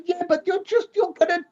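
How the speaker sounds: high, exasperated telling-off; incredulous rise at the pub-goer; exasperated warning. Retold or imitated. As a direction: pitch it up, pleading and scolding. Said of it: "And you're".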